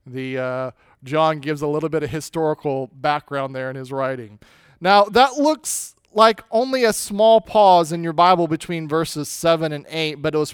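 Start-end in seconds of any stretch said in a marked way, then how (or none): none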